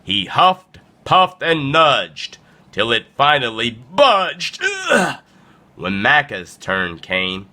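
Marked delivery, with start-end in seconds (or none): 4.6-5.2 high, strained groan of exertion; acted straining noise; effortful grunt. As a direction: groan as if shoving a boulder. Strain of pushing a boulder.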